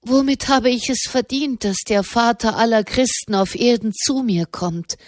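usual speaking voice

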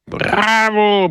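high shouted cheer; roar. A narrator's reading. The assembly cheers Don Camillo's exile, high roar. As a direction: bellow it as a crowd. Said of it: "brüllte die Versammlung".

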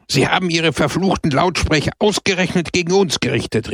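high-pitched indignant exclamation; indignant outcry. Acted. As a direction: cry out in outrage at the loudspeakers. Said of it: "rief er aus".

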